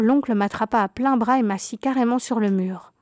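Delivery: ordinary delivery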